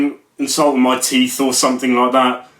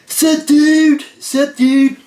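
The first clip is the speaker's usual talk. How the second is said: much higher and louder mock surfer dude; hammed-up dude greeting; 12 semitones higher, 4 dB louder, much slower than usual. Retelling a stranger's repeated reply as a loud, high mock dude. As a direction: crank the bro greeting way up, hammed-up. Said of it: "And he said".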